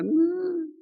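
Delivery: a sudden high, far-off "No"; Peter's denial. Retold or imitated. Preacher voicing Peter's sharp denial to Jesus. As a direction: jump up in pitch for the flat denial.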